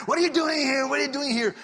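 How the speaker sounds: high, loud angry holler; imitated screaming. Tattooed man running at the man in the car, imitated scream. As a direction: yell it high at the intruder. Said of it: "he hears the screaming".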